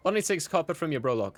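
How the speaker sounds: lightly voiced cheery barkeep; name the price with friendly pep; Gideon. Innkeeper quotes price to regular.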